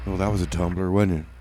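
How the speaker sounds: normal talking voice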